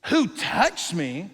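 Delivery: drawn-out, slow who touched me; a slow searching question; slow earnest question. Shown as a demonstration. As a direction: ask it slowly, seriously. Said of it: "restated slowly after mockery".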